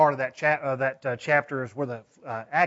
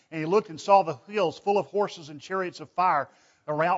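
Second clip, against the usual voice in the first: ordinary talk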